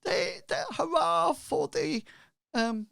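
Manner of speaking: very high, whooping cheer; cheering villager. Acted. Townsperson cheering the new protectors, high excited call. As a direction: hail the heroes in a piercing high voice. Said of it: "And somebody goes".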